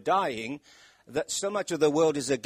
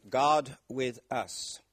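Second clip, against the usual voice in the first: slow and weighty; much slower than usual. Shown as a demonstration. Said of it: "which means".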